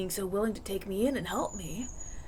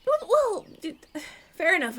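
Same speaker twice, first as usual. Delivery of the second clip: flustered; 10 semitones higher, 4 dB louder than usual. Acted. Flustered after offending hosts.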